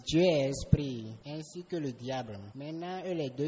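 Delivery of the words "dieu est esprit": much louder, emphatic repeat; raised voice, making the words carry. Real, not acted. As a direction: say it up loud for someone who missed it.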